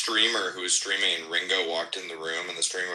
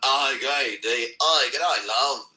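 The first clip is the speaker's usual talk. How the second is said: [name] attempt; 5 semitones higher, 4 dB louder, slower than usual. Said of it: "let me think [name]".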